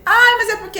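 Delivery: high, loud whiny protest; whiny excuse. Retold or imitated. Child pleading with parent, high loud whiny excuse. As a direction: whine out a lame excuse.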